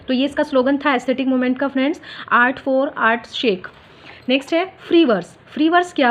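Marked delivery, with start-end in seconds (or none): 2.3-3.7 slow dictation. Shown as a demonstration. Slogan repeated for notes.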